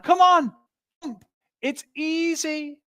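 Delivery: high, loud call across the water to the other side; shout across. Retold or imitated. Calling to friends back in Loserland, a loud high shout. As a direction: yell it out, inviting them over. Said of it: "you're gonna shout over to Loserland".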